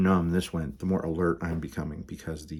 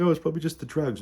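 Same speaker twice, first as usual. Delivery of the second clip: much higher, airy dismissive tone; mock self-reassurance; 10 semitones higher, faster than usual. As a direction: brush it off in a silly high voice. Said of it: "And I was like".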